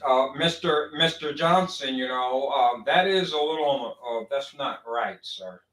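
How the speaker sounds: a hint of a scared friend trailing off; timid hesitant friend. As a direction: stammer out a meek correction, fading away. Mock timid friend correcting.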